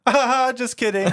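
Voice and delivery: loud high fake laugh into a joke; cashier yelling. Cashier revealing the prank to a customer, imitated jolly yell. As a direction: cackle it out at full volume, hammed-up. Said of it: "he was, like, yelling".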